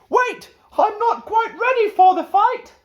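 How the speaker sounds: very high squeaky scared knight; nervous knight. Acted. Knight begs the dragon to wait, high and scared. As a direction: squeak it like a knight losing his nerve.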